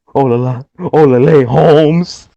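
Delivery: hammed-up singsong hello; exaggerated Spanish-accent hello; mock Spanish greeting. Mocking Kalisto voice.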